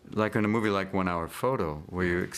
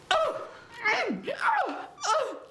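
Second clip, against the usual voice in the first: high tennis grunts; slower than usual. Retold or imitated. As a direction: shriek like a tennis player hitting. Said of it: "very vocal when they play".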